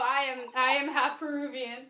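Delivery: ordinary talk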